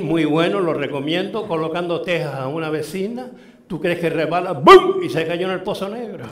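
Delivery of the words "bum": sudden loud, high boom; explosive vocal "boom"; loud crash noise. Retold or imitated. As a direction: imitate a body crashing down. Imitates the fall.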